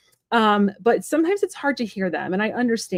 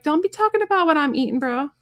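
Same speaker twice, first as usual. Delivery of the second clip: annoyed retort; 7 semitones higher than usual. Retold or imitated. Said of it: "I was so annoyed".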